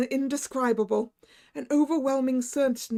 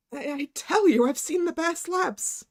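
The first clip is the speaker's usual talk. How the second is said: higher, louder desperate insisting; frantic insistence; 6 semitones higher, 4 dB louder than usual. Acted. Narrator insisting to the doctor, frantic and loud. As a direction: plead that he saw it.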